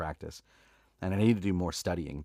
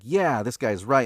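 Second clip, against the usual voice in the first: loud, higher, drawn-out approval; acted agreement; 5 semitones higher, 9 dB louder than usual. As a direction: side with him emphatically. Acting out listener's first reaction.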